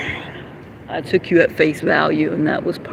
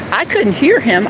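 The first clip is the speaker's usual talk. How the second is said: usual speaking voice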